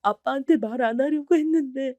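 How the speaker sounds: weep it out to her father; sobbing. Acted. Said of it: "엉엉 울면서 말을 해버렸답니다". (엉엉 울면서 아빠에게 털어놓는 목소리)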